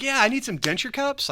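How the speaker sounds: high-pitched, put-on confidence; overly sure customer request; really confident. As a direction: sell it as if you mean it. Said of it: "like, really confident in this".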